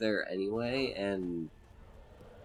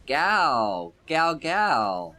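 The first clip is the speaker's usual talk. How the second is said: dragon shout; 5 semitones higher, 8 dB louder, much slower than usual. Retold or imitated. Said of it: "He's going like".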